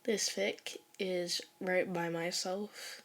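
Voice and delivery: usual speaking voice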